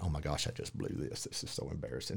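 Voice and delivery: low, hushed, deflated retelling of embarrassment; embarrassed aside. Retold or imitated. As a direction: say it small and quiet, as if mortified. Retells his embarrassed walk out.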